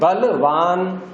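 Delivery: बलवान drawn out, ending in -vaan; बलवान said slowly as an example; model word form. Shown as a demonstration. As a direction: stretch बलवान slowly with a clear v. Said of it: "अब धनमान नहीं बोलेंगे".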